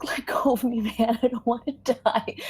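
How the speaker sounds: high, strained, urgent pleading; frightened pleading. Acted. Boy held back from escaping, begging in fear. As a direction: plead with pitch rising as the fear builds.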